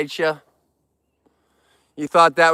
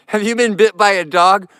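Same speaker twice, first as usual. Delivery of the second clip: exasperated; 5 semitones higher, 6 dB louder than usual. Real, not acted. Repeats viewer's question, exasperated.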